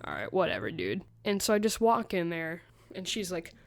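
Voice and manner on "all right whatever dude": dismissive dude voice. Retold or imitated. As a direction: brush her off in a deep voice. Narrator dismissing the teacher.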